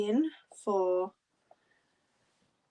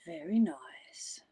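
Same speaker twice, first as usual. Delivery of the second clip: slow whisper; much slower than usual. Real, not acted. Admiring Austria, slow and soft.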